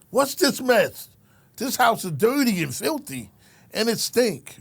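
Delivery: high, loud scolding officer; mock officer yelling about dirt; retold yell. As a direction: bark it out like an angry officer.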